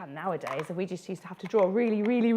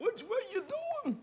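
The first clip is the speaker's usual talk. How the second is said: put-on much higher, soft squeaky old man mouse; old mouse scolding; 7 dB quieter than usual. Old mouse challenges a stranger, squeaky and cross. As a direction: pipe up like a tiny grumpy old mouse. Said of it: "He's like".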